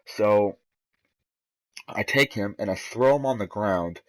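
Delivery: ordinary talk, nothing marked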